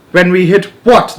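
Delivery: loud, high alarmed question; cried out. A narrator's reading. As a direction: cry out in alarm. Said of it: "they cried".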